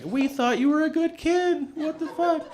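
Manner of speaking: high, appalled parent voice; mock-scandalized mom and dad; shocked parents. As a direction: voice the horrified parents.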